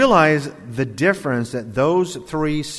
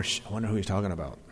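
hushed gossip; 6 semitones lower, 9 dB quieter, much faster than usual. Retold or imitated. Mock whispered gossip in pews.

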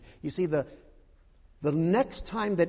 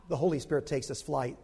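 normal talking voice

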